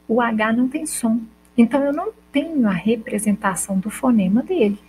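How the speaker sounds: normal talking voice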